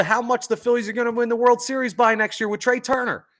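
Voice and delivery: sudden high excited rush; excited joke. Real, not acted.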